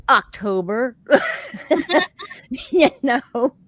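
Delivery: says it through a laugh; laughing while delivering the punchline. Real, not acted. Deadpan punchline retold through laughter.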